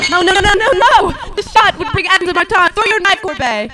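loud frantic no, no, no; desperate cry to throw the knife; panicked shout. Acted. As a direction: yell it in a panic to stop him. Panics as shadow is found.

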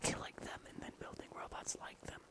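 hushed plotting whisper. Pretend. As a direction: whisper the evil scheme.

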